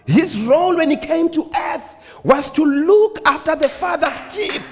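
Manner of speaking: high, ringing preacher voice; impassioned preaching. Acted. Preacher telling congregation of David and the good shepherd, impassioned preaching.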